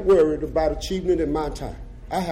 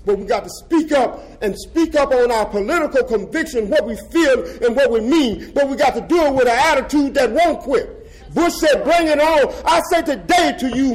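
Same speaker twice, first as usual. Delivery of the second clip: higher and louder, building to a shouted "stand up"; fiery rally speech; 9 semitones higher, 5 dB louder than usual. Real, not acted. Former death row prisoner rousing socialist comrades, fiery preaching. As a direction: whip the crowd up, voice climbing to a shout.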